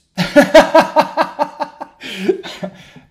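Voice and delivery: hearty burst of laughter; loud laugh. Real, not acted. Laughs at no data today.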